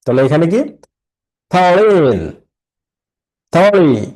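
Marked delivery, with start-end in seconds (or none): 1.5-2.3 "foreign" raised, with a long falling end; syllable stress. Shown as a demonstration. Teacher repeating for students, stressing "foreign" with drawn-out end. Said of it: "the stressed syllables". 3.5-4.0 "থরি" as a strong, isolated beat; syllable stress. Shown as a demonstration. Teacher showing students the clap word, stressing "থরি". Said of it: "the stressed syllables".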